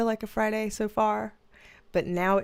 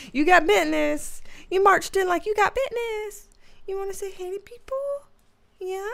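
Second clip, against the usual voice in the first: higher, sing-song pet voice; talking to the dog; 7 semitones higher than usual. Real, not acted. Owner teasing her dog for strutting in, high pet-talk voice. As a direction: coo at the dog like he's a little boss.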